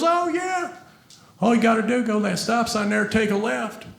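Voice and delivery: lightly voiced attendant on a crackly intercom; through a booth microphone. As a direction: play the gas station guy on his mic. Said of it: "the one guy in the booth with his microphone".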